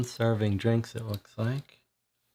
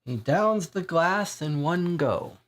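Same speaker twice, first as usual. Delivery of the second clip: ordinary talk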